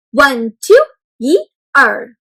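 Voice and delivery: put-on yi, er said loud and clear for kids to copy; sing-song teacher counting; counting in Chinese. Shown as a demonstration. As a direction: model "yi, er" as a teacher. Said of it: "maybe you can try to say them too".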